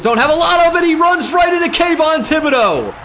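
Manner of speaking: rising to a high, loud shout on the sack; play-by-play building to a shout; excited call. Real, not acted. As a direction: call the big defensive play.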